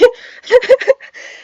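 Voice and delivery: high burst of laughter. Real, not acted. Laughing fit after creepy joke.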